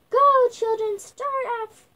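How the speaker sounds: loud, high starting call. A narrator's reading. Character sends children running off.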